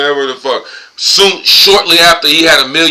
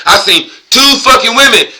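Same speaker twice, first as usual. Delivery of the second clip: ordinary delivery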